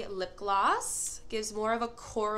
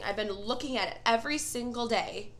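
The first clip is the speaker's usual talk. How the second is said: ordinary talk, nothing marked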